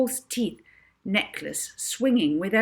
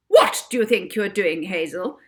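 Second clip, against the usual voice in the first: loud, furious matron; 9 dB louder than usual. Acted. Miss Addiston scolding Hazel, loud and furious. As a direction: bark at the child. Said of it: "Miss Addison said furiously".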